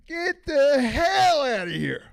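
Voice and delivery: loud, slow, drawn-out get out; a fed-up worker storming off; mock blow-up. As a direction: blast it like he's done with them. Acted quote of quitting.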